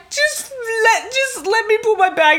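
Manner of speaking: high, wailing, fake-sob plea; mock crying. Pleading with the guard for her bag, mock sobbing. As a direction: whimper it like a begging child. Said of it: "I start crying".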